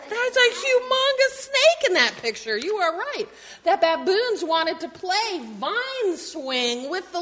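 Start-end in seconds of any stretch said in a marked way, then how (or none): 0.1-2.6 high, louder amazed remark; excited. Real, not acted. Teacher showing kids the picture, high and excited. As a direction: point out the snake with delight.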